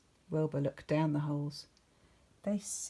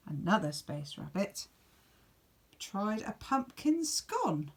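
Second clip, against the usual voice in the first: plain reading voice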